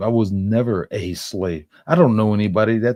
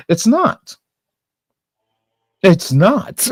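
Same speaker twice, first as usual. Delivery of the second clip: indignant shout; 11 semitones higher, 7 dB louder, slower than usual. Real, not acted. Retorting to the read-out clip.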